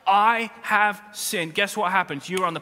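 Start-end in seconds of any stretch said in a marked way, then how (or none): 0.1-1.5 "I have sinned" said slowly and loud; slow confession. Shown as a demonstration. Spouse owning up, slowly. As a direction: lean on each word. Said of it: "you say to your spouse".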